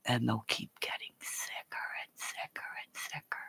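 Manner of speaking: voiceless whisper of doom; cursing whisper. Real, not acted. Streamer warning donors in a cursing whisper. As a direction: hiss the curse softly.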